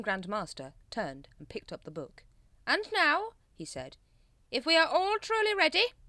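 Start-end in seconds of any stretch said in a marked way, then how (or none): 2.7-3.3 high, loud announcement; pompous Grand Master. A narrator's reading. Grand Master resumes ritual. 4.5-5.9 high, loud ceremonial voice; pointed, pitched-up leader voice; pompous Grand Master. A narrator's reading. Grand Master resumes ritual.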